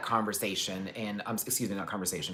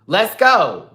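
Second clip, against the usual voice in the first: high, much louder dare; mock loud dare; 8 semitones higher, 11 dB louder than usual. Bike man daring him on the train, loud mock dare. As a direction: bark it like picking a fight. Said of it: "He just kept trying it".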